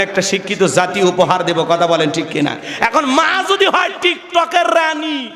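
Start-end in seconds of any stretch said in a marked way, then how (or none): 2.8-5.3 laughing shout. Real, not acted. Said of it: "preacher shouts with laugh".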